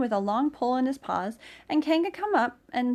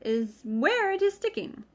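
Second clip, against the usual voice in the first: Rabbit; 9 semitones higher, 4 dB louder, slower than usual. A narrator's reading. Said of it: "said Rabbit".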